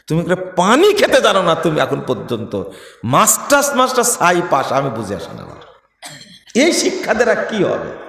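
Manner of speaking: usual speaking voice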